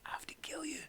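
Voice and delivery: hushed, voiceless confidential whisper; barely audible, conspiratorial aside. Retold or imitated. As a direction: whisper it like a dangerous secret. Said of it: "I whispered to her".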